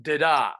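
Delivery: da-da with a short unstressed first beat and a longer stressed second; unstressed, then stressed; iambic da-da. Shown as a demonstration. Teacher showing students what an iamb sounds like. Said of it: "an unstressed syllable and a stressed one".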